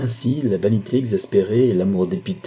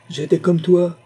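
high, louder bitter retort; 10 semitones higher, 5 dB louder than usual. A narrator's reading. Numa answering his disbelieving wife, bitter. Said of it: "dit Numa avec un rire amer".

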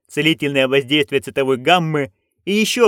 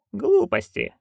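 dismissive; 3 dB quieter, slower than usual. Acted. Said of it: "перебила матушка".

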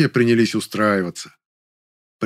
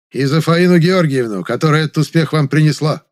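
raised, pointed addition to a toast; slightly caustic; 5 semitones higher, 5 dB louder than usual. A narrator's reading. Popov adds to the director's toast, slightly caustic. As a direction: chime in loudly with a slight barb. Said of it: "Немного язвительно добавил Попов".

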